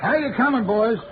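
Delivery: loud call down into the grave. Acted. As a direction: shout across to the workmen. Calling down to diggers.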